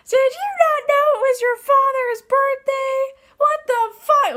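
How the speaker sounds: very high, loud, slow mocking scream of an angry mother; imitating a shrieking mother, high and loud; mom screaming. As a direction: belt it out like a furious Italian mom yelling down the phone. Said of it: "She screams".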